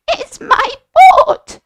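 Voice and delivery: high, laughing goat voice; giggly goat. Acted. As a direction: squeak it out with a giggle like a silly goat.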